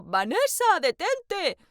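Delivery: high, loud call of her name; shouting after her. Acted. Julián chases Vanessa through the rain, high loud shout. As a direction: yell after someone running away in the rain. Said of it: "Él corrió detrás de mí gritando".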